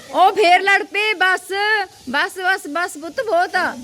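calling out firmly to break up a fight; scolding call. Real, not acted. Stopping the kids fighting again.

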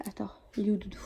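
hushed whisper. Real, not acted. Mother looking for the cuddly toy beside the sleeping baby, whispering.

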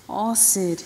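ordinary delivery, nothing marked

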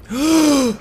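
sharp gasp of delight; excited gasp. Real, not acted. Gamer sees the base powering up, delighted gasp. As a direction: gasp in amazement.

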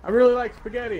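character line done in a mocking voice; mock game character voice. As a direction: parrot the character's line, hammed up. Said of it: "it's like".

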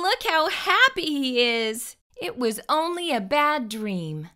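plain reading voice

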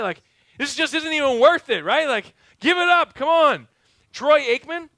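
loud, high exasperated rant; frustrated fan. Retold or imitated. Preacher joking about the Cowboys, exasperated loud yell. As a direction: yell like a fed-up football fan.

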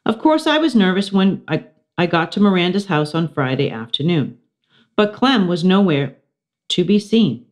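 ordinary delivery, nothing marked

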